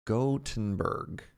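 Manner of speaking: Gothenburg said slowly, syllable by syllable; copying the pronunciation clip slowly; careful pronunciation. Shown as a demonstration. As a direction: draw out Gothenburg slowly like the recording. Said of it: "[name] says more like Gothenburg".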